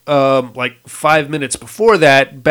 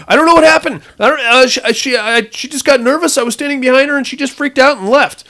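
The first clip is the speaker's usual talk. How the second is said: higher, stammering excuse; dad excusing himself to the leader, higher and flustered; 9 semitones higher, faster than usual. Retold or imitated. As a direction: babble it higher, panicky.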